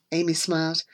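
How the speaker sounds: ordinary delivery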